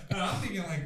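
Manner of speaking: usual speaking voice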